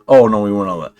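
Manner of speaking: usual speaking voice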